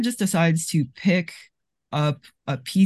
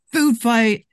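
a louder, higher cry; mock scream; 6 semitones higher, 6 dB louder than usual. Erica shoves the candy at Necktie and gives a mock scream. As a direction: belt out the words. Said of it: "screams it".

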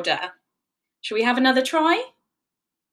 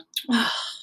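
soft, breathy letdown sigh; little dinosaur sighs at bedtime news; disappointed sigh; 4 dB quieter than usual. Acted. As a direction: sigh at being told no. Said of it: "said Arya".

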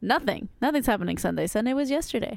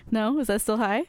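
ordinary talk

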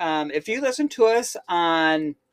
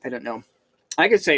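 normal talking voice

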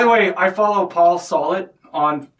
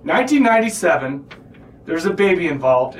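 ordinary talk, nothing marked

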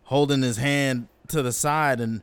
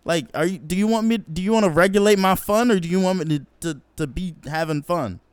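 confused outrage; 6 semitones higher than usual. Retold or imitated. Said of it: "I was".

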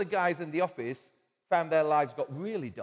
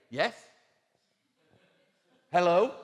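bright, raised call of "Hello?"; answering call; 3 semitones higher, 8 dB louder than usual. Retold or imitated. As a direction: answer like someone picking up the phone, a bit louder. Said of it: "I would say".

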